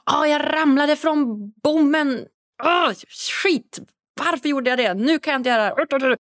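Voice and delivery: a hammed-up outburst after falling off the beam; mock inner scream. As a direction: act out the body yelling at you after a fall. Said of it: "hela kroppen skriker till dig då så här".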